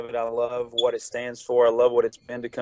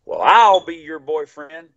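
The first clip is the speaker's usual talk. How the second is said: imitated distinctive voice, louder and drawn out; 3 semitones higher, 8 dB louder than usual. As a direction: stretch it out as a playful, loud offer. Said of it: "he had a very distinct voice".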